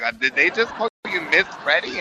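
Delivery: mother asking, taken aback; shocked mother. Retold or imitated. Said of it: "And my mother would be like".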